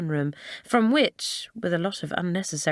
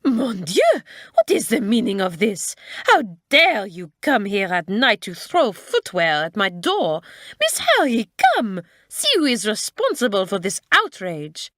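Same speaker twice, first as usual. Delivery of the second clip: hot-tempered French teacher scolding; outraged cry; 2 semitones higher, 4 dB louder than usual. A narrator's reading. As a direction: explode with indignation in a French accent. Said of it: "the French teacher cried".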